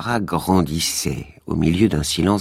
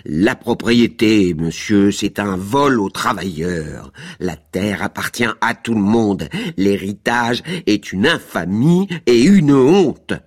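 loud, slow ranting shout; heated shouting; 5 semitones higher, 4 dB louder than usual. A narrator's reading. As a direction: declaim it like a hothead. (criant avec fougue, fort et lent)

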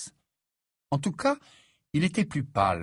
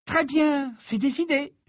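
high-pitched, louder, decisive schoolgirl; energetic; 4 dB louder, slower than usual. Acted. Hermione settles the plan with Harry, energetic high voice. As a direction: wrap it up with brisk high energy. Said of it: "conclut Hermione d'un ton énergique".

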